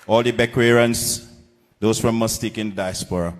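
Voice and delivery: loud rally call-out to the crowd; shouted greeting. Real, not acted. Candidate greets Bequia crowd at rally, loud shout.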